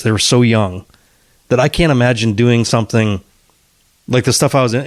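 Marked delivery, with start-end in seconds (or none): none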